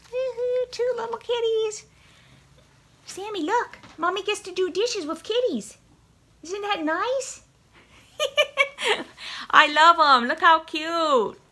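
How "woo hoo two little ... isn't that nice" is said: high-pitched singsong cooing; high pet talk. Real, not acted. Cooing to cats over sponges.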